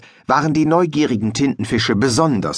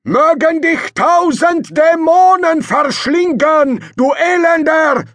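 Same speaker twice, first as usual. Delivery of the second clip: much higher, louder, slow cursing shout in a different voice; foreign rival's shout; 6 dB louder, slower than usual. Acted. The rival calls a curse across the sea, high, slow and loud. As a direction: call the curse slowly across the water. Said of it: "Eine Stimme rief übers Wasser".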